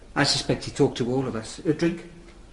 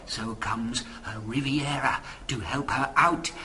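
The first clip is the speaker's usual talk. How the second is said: usual speaking voice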